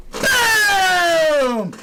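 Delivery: high loud drawn-out boom; victory shout. Real, not acted. Vlogger to viewers celebrating, loud boom shout. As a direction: slam it down like a mic drop.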